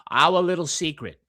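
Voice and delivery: Boston accent, our as two syllables. Shown as a demonstration. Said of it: "names of our books in, in Boston accents is the best".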